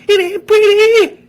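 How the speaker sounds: very high, loud yelp; hiccuped cry. A narrator's reading. Ziggy's last yelp before leaping into the crowd, very high hiccup. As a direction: hiccup it out in falsetto. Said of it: "hiccuped out".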